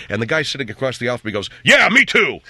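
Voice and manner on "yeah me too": loud, high mock shout; moviegoer calling out. Man across the aisle answers the screen, loud shout.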